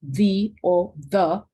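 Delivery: "The o the" said very slowly, contrasting the two sounds of "the"; both pronunciations of the. Shown as a demonstration. Teacher models both pronunciations of "the" for the class, very slowly. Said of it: "cualquier pronunciación que quieran utilizar".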